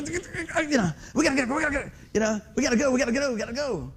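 restless, repeated urging; hyper impatience. Acted. Preacher showing how hyper he gets when boxed in, antsy. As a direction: act out being boxed in and itching to go. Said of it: "I'm a little hyper anyway".

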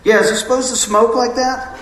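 high, loud fake alarm about flames; hammed-up panicked caller; mock panic on the phone. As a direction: play it up like the machine is burning. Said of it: "I wanted to just go".

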